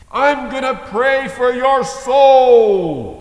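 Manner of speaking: loud, high, slow raging shout; furious yell. Retold or imitated. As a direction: bellow it like a man in a fury. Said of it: "erupts in this rage".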